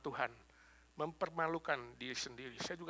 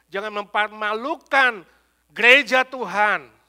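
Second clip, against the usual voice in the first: slow, swelling preacher's appeal, louder to the end; building plea; 6 semitones higher, 14 dB louder, slower than usual. Real, not acted. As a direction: drag each jangan out and build to a roar. Preacher pleads with congregation.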